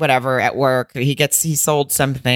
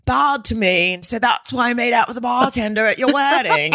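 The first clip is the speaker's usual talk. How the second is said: higher-pitched, exaggerated Australian accent; 7 semitones higher than usual. Boyfriend blaming his dad for cheating at a wedding, mock Australian accent. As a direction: play the excuse-making boyfriend in mock Australian. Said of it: "that's my Australian accent".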